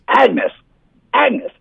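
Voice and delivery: put-on high-pitched old man calling his wife; elderly man. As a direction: imitate an old husband calling out. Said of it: "eighty, late eighties".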